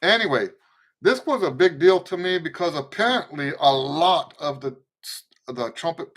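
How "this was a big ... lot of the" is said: ordinary talk, nothing marked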